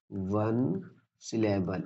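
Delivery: "वन syllable" drawn out slowly and softly; slow emphatic repeat. Shown as a demonstration. Teacher repeats term slowly for learners. ("वन syllable" धीरे-धीरे, रुक-रुक कर)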